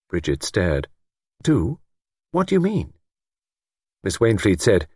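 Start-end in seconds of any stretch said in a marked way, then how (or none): 1.4-2.9 high-pitched, taken-aback question; puzzled. A narrator's reading. Young woman questions older woman's alarm, puzzled.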